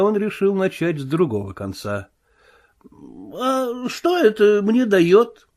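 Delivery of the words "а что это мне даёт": higher, slow aggrieved whine; bitter grievance. Acted. Marvel bargaining with the invisible man, higher aggrieved whine. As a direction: complain like a cheated man. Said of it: "тоном горькой обиды".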